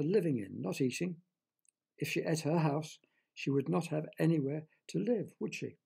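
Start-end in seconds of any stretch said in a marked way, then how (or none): none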